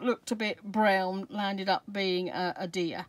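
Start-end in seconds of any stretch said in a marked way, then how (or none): none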